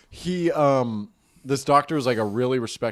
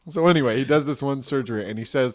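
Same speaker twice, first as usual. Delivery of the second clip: ordinary talk, nothing marked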